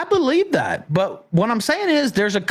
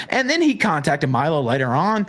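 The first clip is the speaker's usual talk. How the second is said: usual speaking voice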